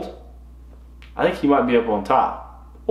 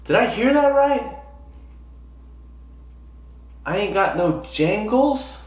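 high-pitched, puzzled echo; baffled questioning; 6 semitones higher, 4 dB quieter than usual. Retold or imitated. Golfer doubting what he heard from cart girl, baffled. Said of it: "I'm thinking in my head".

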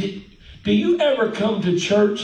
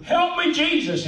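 high, gliding down as if slowly sinking; hammed-up cry fading downward; mock drawn-out plea; 11 semitones higher than usual. Preacher mimicking Peter's slow sinking, high mock plea. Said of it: "this picture of, of Peter going".

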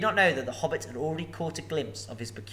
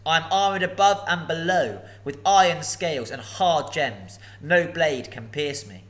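louder dragon boast; proud dragon; 4 semitones higher, 7 dB louder than usual. Acted. Dragon correcting Bilbo, loud proud. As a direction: insist haughtily. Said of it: "he snapped".

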